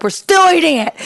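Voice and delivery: high, loud insistence; mock-furious declaration; defiant snap. Wife snapping at John over the fallen pasta, high and defiant. Angry drunk retelling pasta fight.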